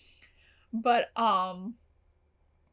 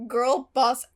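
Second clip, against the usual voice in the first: ordinary talk, nothing marked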